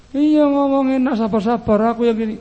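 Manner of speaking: high, loud mock protest; put-on high-pitched grumbling; complaining wife. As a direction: protest back as an exasperated wife. Wife snapping back at soothing husband.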